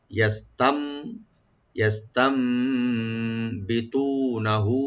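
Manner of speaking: slow, drawn-out chant of the word; very slow recitation. Shown as a demonstration. Teacher reciting the word slowly for students. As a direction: draw every vowel out as a model. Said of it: "তু এক আলিফ টানবেন".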